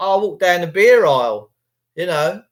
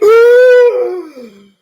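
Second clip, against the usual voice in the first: newborn's first gasp; 13 semitones higher, 11 dB louder than usual. Retold or imitated. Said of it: "I went".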